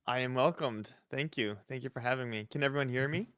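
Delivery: soft and winded after a hard ride; out of breath. Real, not acted. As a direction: speak while still catching breath. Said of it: "I'm still catching my breath".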